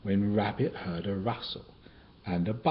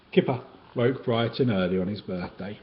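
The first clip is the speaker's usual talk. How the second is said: ordinary delivery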